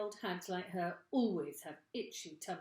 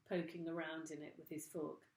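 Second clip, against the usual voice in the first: plain reading voice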